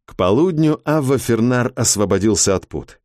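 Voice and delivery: plain reading voice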